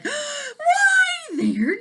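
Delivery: very high loud delighted shout; yelling. Acted. Dragon loves the peel, shouting with delight. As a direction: shout it with joy while jumping.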